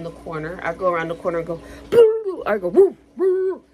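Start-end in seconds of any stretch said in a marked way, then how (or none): 1.9-2.4 loud, high Boo; jumping out from around a corner to scare someone; scare shout. Retold or imitated. Scaring people at corners. 2.7-3.6 loud, high ghostly hoot; spooky scare noise. Retold or imitated. Scaring people.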